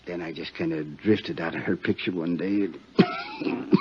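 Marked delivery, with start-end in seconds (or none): none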